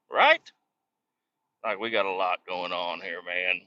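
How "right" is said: indignant tag question. Retold or imitated. Retelling his reply to workers.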